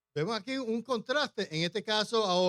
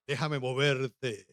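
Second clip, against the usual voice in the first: slow, lowered show-off courting voice; suitor's changed voice; 6 semitones lower, slower than usual. Acted. Jacob offering Rachel his muscle, suitor's changed voice. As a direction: drop it low to impress a lady. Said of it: "cambia la voz".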